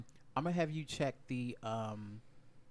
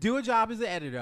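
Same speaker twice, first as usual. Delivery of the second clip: loud; 9 semitones higher, 9 dB louder, faster than usual. Real, not acted. Loudly telling editor to cut.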